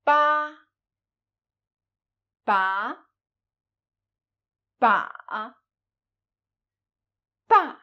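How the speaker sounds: "Ba" said in turn with high level, rising, dipping and falling tones; four tones of ba. Shown as a demonstration. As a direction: drill "Ba" through all four Mandarin tones. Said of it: "let's pronounce it with the finals".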